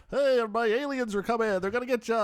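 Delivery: lightly hammed-up vintage radio warning; old-time radio announcer. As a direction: hype up an alien invasion like a vintage broadcast.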